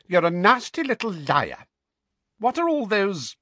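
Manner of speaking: high, loud spiteful scold; scolding aunt. A narrator's reading. Aunt calling the boy a liar. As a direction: spit it at him.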